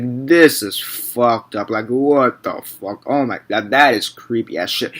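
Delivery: slow, drawn-out disbelief; understated, dragged-out shock; stunned horror reaction. Acted. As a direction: say it slowly, as if stunned by something creepy. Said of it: "that's your scream".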